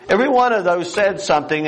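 ordinary talk, nothing marked

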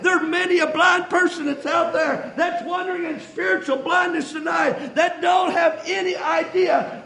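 high-pitched, driving sermon delivery; impassioned preaching. Real, not acted. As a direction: preach it hard, phrase after phrase. Preaches at full force.

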